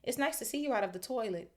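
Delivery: high, quick fake cheer; falsely bright. A narrator's reading. Hermione placating Myrtle, falsely bright. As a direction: hurry out a too-bright pleasantry. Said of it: "in a falsely bright voice".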